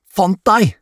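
high-pitched cry of discovery; gleeful shriek. A narrator's reading. Adam finds Mia behind the sofa, shrieking high. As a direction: shriek it high, without much extra volume. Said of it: "skriker han".

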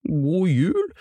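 high, put-on festive gentleman voice; hearty gentleman's greeting. A narrator's reading. Fine gentleman greets another, acted. (munter julehilsen fra en fin herre, lyst tilgjort)

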